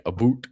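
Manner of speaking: "a boot" for about, said with an oo; Canadian "a boot". Retold or imitated. Streamer mimicking how all Canadians supposedly say it, Canadian "a boot". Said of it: "all Canadians say".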